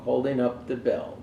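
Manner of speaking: plain reading voice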